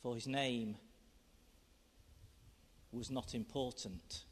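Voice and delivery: quiet reflective poem reading. Acted. Reads poem aloud.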